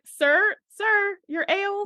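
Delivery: high-pitched imitation of calling out; calling after someone. Bartender retold, calling after Sam about his ale, high-pitched. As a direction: imitate a server trying to catch a customer.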